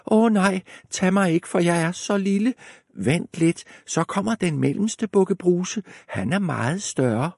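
high, pleading little goat; small goat pleading. Acted. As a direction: beg in a tiny high voice.

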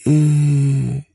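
high, drawn-out stalling sound; hesitant. A narrator's reading. Small boys asked for a driving licence, hesitant. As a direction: stall in a high little-boy voice.

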